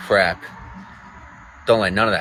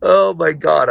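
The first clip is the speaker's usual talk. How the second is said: excited; 8 semitones higher, 7 dB louder, slower than usual. Real, not acted. Excited greeting to Samantha.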